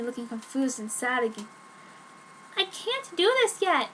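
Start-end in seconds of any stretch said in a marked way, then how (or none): 2.6-3.9 put-on high, bright girlish voice for the heroine; Joan's voice. Teen girl pleading with her vampire boyfriend, pitched up.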